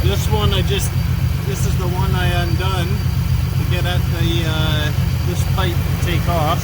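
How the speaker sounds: talking loud from under a car; lying under a car. Real, not acted. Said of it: "I'm under the car".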